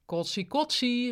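slow, high teasing call. A narrator's reading. Ruud calls out about his queasy colleague, slow loud call. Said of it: "riep Ruud".

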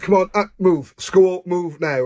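roust a lazy teenager out of bed, half-mock; mock copper ordering him up for school; stern wake-up order. Officer rousing him for school.

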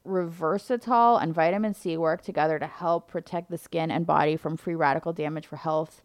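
usual speaking voice

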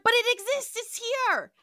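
high, loud squeaky protest; mock computer protest. Retelling the computer pleading with Kirk, high and loud. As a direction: whine it like a panicked machine. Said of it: "Landru's like".

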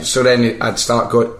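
ordinary talk

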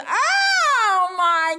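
high, loud shocked cry; shocked shriek. Retold or imitated. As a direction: shriek it out in disbelief. Drag queen shocked by real breast.

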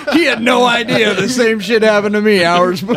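can't stop laughing while telling it. Real, not acted. Telling friends the punchline, laughing hard.

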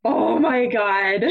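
slow, laughing oh my God; real, helpless laugh breaking in; laughing disbelief. Real, not acted. Retelling the vanilla mix-up.

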